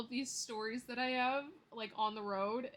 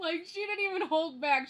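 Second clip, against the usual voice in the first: laughing; 6 semitones higher, 8 dB louder than usual. Real, not acted. Laughing while retelling the clerk.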